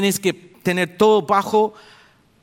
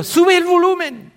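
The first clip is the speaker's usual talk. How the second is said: high, loud call to the sound man; 13 semitones higher, 7 dB louder than usual. Retold or imitated. Preacher calls to the sound man for volume. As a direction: shout the order up high. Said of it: "y yo".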